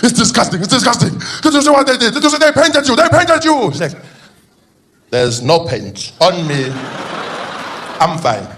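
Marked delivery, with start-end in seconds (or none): 0.0-3.7 high, loud, agitated outcry; breathless-sounding indignant alarm, pitched way up; outraged reporting. Acted. Acting outraged aide reporting painting. 5.1-8.5 slow, chilled-out politician voice; laid-back, drawn-out impersonation; Jacob Zuma. Acted. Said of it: "he's a chilled out guy".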